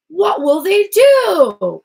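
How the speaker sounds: loud, high, drawn-out rhetorical wail; mock-dramatic. Reader asks what companies would do, mock-dramatic. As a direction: stretch it out in exaggerated alarm.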